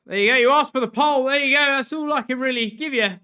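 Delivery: loud, high, excited calling; excited shouting. Real, not acted. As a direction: hype it up to chat.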